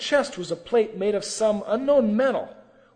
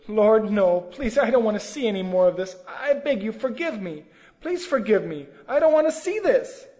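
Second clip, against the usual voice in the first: acted frightened begging, raised voice; distressed plea; 3 semitones higher, 5 dB louder than usual. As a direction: plead desperately to be spared. Acting own distressed plea to Lord.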